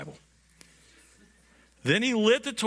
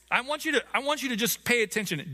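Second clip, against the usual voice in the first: ordinary talk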